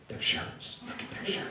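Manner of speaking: hushed retold whisper, words barely voiced. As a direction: whisper it to your friends as a warning. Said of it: "his friends are like".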